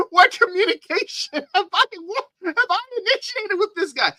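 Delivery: high-pitched, laughing protest; incredulous laughing. Real, not acted. Asking what contact was ever initiated, laughing incredulously. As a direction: squeal the question through real laughter.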